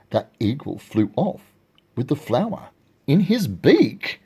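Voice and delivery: ordinary delivery, nothing marked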